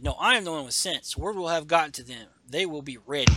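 a proud ronin overruling his friend; firm samurai voice. Acted. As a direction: set him straight with force. Voicing samurai arguing in comic.

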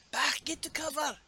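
put-on high-pitched Scottish accent; botched Colossus voice that comes out Scottish. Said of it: "that was a Scottish accent".

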